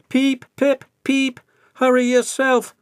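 high, loud cheeky peeping; cheeky little engine. A narrator's reading. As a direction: answer back cheekily. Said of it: "said cheeky Thomas".

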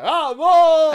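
loud, high cheer; triumphant shout. Real, not acted. Player cheering own success in the game, triumphant shout. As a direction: cry out in victory.

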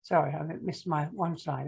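plain reading voice

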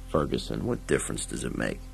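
low, soft, tired reply; weary lawman. Acted. Dredd, tired, answers Ferguson, low and weary. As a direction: let it sink low and weary.

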